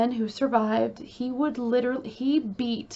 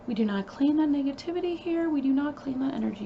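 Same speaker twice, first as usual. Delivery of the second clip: brisk dismissal; 3 semitones higher, faster than usual. Real, not acted. Rejecting negativity, brisk aside.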